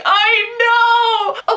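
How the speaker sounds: high, loud wailing I know; half-laughing wail of agony; anguished cry. Retold or imitated. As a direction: cry it out in mock despair. Retold cry to sister.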